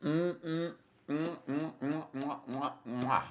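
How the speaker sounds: high mm mm then kiss noises; chef's kiss sounds. Real, not acted. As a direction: savour it with smacking kisses. Savoring delicious food, kissing sounds.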